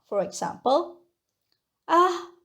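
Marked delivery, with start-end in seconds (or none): 1.4-2.3 "啊" pitched up, with mild admiration; a higher, lightly amazed first tone; surprised admiration. Shown as a demonstration. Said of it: "express surprise and admiration".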